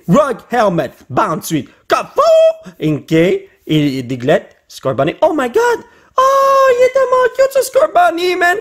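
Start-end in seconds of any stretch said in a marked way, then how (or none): 5.2-8.6 much higher, squealing over how cute it is; gushing over cuteness. Real, not acted. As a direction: coo at the card like a puppy.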